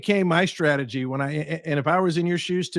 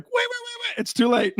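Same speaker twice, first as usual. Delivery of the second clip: much higher, a hammed-up frantic plea; mock desperate pleading. Dumped partner reading the fridge note, mock frantic. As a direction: cry out after someone already gone, in jest.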